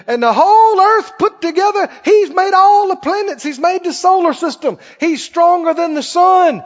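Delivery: high-pitched, building preacher's boast; exultant bragging. Shown as a demonstration. Preacher showing people how to brag on God, exultant preaching. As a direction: pile up the praise with mounting fervor. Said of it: "you talk like that".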